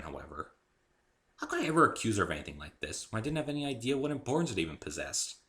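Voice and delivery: ordinary delivery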